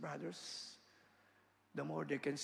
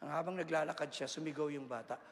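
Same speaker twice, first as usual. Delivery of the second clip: ordinary talk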